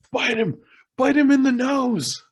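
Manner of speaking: very high mock yell across a bar; strained, high-pitched comic shout; retold yell. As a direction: holler it over the fight, imitating him. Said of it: "he yells over".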